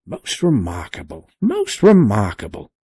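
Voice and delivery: put-on fussy old man muttering to himself; old professor muttering. A narrator's reading. Professor muttering over the placard. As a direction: mumble it in wonder, old and absent. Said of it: "muttering to himself".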